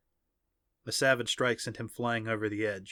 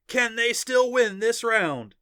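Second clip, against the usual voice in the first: high, loud, slow announcer call; excited announcer; 12 semitones higher, 6 dB louder, slower than usual. A narrator's reading. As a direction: draw it out for the whole arena. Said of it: "the announcer said".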